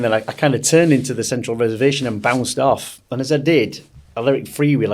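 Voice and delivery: normal talking voice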